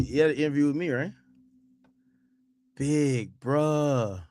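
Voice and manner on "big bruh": Quando's drawl. Retold or imitated. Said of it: "Didn't he have an interview".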